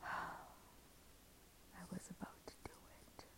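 barely a breath, slow and unvoiced; hushed whisper. Real, not acted. To the camera about the bridge, a slow whisper. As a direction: whisper it as if it hurts to say. Recalling nearly ending her life.

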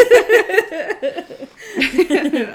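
drawn-out laughing, high and loud. Pretend. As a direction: stretch it out while cracking up. Laughing, drawn-out parody lyric.

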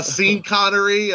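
Sean as SEEN in Sean Connery; jokey misreading, Sean rhyming with bean; literal Sean. Shown as a demonstration. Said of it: "Yeah, you're seen".

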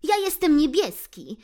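high, loud, earnest lecturing voice; brave blue friend. Acted. Blue lectures the cats, high earnest voice. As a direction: face the cats and reason with them. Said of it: "Blue powiedział na to".

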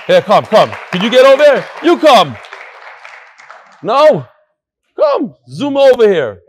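loud call across the room to come up. Real, not acted. Rabbi loudly calling a learner to the front, loud call. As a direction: wave him over with a loud call.

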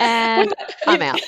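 slow mock mic drop, laughing; bow out through laughter; laughing sign-off. Real, not acted. Cohost jokingly signing off, laughing out loud.

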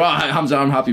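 high, lightly put-on whiny protest; mocking protest. Viewer arguing with Hamza, lightly mocking high protest. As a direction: mimic the viewer arguing back. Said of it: "you'll start arguing with me and you're".

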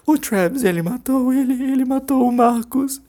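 high, trembling terrified voice; shaking high voice of shock. Acted. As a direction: stammer it out in terror. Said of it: "Carmona tinha uma voz trêmula".

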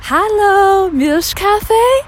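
café owner greeting a regular in a high, bright "Milch Cafe?"; imitating Mona. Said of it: "she was like".

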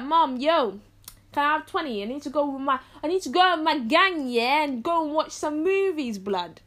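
put-on botched street-slang accent; failed attempt at an accent. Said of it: "I don't really know what accent I was trying to do".

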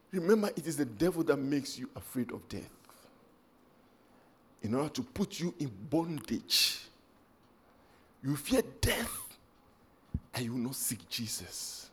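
choked-up, quiet plea; emotional hush. Real, not acted. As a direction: pull the church in close, barely voiced. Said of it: "I'm so emotional about this".

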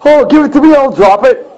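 high, whiny mock of commenters. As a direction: sneer it up high like a know-nothing. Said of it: "These jokers that are in the comments going".

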